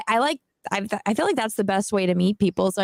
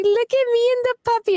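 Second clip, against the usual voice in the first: puppy's voice; 13 semitones higher, 3 dB louder than usual. Retold or imitated. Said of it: "so he's like".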